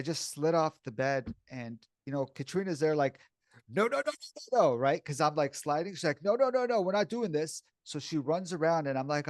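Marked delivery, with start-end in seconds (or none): 3.7-4.8 rapid-fire high-pitched imitation of panic; alarmed protest. Retelling partner's alarm as he slid off the bed, high and urgent. Acting out Katrina's alarm as he slides. 6.2-7.6 high, louder imitation of urgent protest; alarmed refusal. Retelling partner trying to stop him sliding, high-pitched and urgent. Acting out Katrina stopping his slide.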